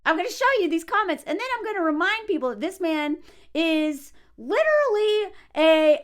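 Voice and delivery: normal talking voice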